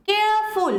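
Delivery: Careful with care held and ফুল dropping; stress on first syllable. Shown as a demonstration. Teacher repeating the rule's example, careful stressed on care. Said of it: "কেয়ারে বেশি জোর পড়লো".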